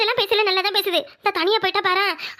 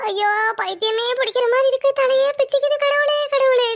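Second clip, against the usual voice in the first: despairing wail; 5 semitones higher, 3 dB louder than usual. Acted. Woman wailing in despair.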